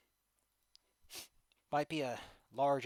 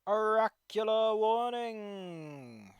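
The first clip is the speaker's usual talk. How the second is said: slow booming dramatic announcement; booming announcer; 7 semitones higher, 8 dB louder, much slower than usual. Acted. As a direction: proclaim it like an oracle.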